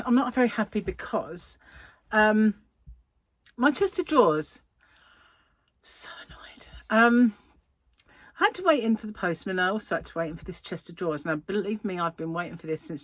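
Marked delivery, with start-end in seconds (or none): 5.8-6.7 breathy annoyed whisper under her breath. Real, not acted. Whispering her annoyance to viewers over the late delivery. Venting about delayed chest of drawers.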